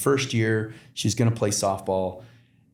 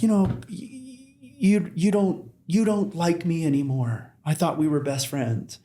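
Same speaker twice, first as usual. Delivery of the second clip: much higher, tearful schoolgirl voice; crying little girl; 8 semitones higher than usual. Retold or imitated. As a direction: squeak it out like a hurt little girl. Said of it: "she's like".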